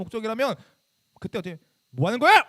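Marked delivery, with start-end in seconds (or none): 1.9-2.4 acting out a loud, angry telling-off; retold scolding shout. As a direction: bark it at misbehaving students, as a re-enactment. Acts out past scolding of students. (버럭 호통치는 재연)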